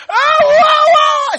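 very high, loud wailing cry; full-volume mock wailing; screaming wail. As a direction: scream and wail as if begging for attention. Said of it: "You are screaming".

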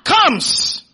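loud, punched single word; leading a shout. Shown as a demonstration. Preacher feeding words to the church, loud shout. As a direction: belt it out for the crowd. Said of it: "Come on, shout".

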